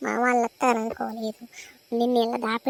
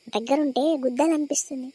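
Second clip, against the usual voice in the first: usual speaking voice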